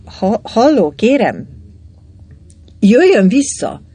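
a frantic yell after someone walking off; calling after him. A narrator's reading. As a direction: call him back in a panic. Calling after departing man.

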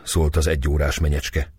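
ordinary delivery, nothing marked

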